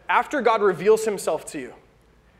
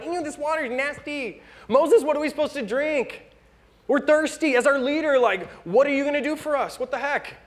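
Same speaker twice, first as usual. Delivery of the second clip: higher, whiny mock complaint; mock grumbler; 6 semitones higher than usual. Preacher voicing the Israelites grumbling at Moses, higher and whiny. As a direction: whine at Moses like a spoiled crowd.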